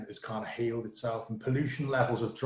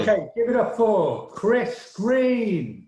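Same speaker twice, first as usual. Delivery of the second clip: loud, slow, higher host build-up; announcer intro; 8 semitones higher, 7 dB louder than usual. Real, not acted. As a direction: bring the next act on big. Host introducing next comic.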